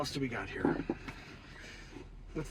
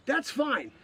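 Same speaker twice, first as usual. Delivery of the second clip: ordinary talk, nothing marked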